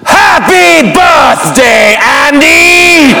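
drawn-out, slow booming Vader greeting; full-out Vader bellow. Retold or imitated. Teen Vader bellowing birthday greeting at party. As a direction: give it everything as Darth Vader. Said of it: "I give it all I got".

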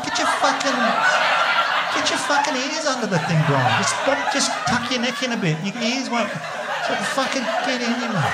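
high, frantic half-laughing urging. Retold or imitated. Father hurries injured Batman into his car, frantic and laughing. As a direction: cram him into the car, laughing in panic. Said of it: "I was like".

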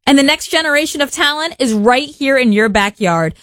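upbeat, polished sponsor promo; radio ad read. Acted. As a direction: sell the local soccer team with energy. Host reads a soccer sponsor ad.

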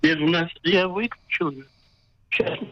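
normal talking voice

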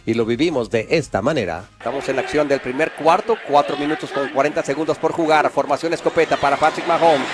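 ordinary delivery

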